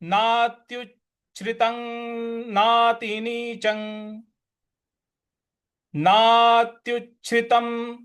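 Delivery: slow, careful chanted words; verse recitation. Shown as a demonstration. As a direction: recite clearly. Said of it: "अगला पद है".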